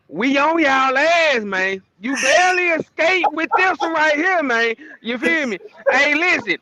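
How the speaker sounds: high-pitched, excited gloating at a rival; gloating trash talk. Real, not acted. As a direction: rub the win in his face, grinning. Trash-talking rival school's host.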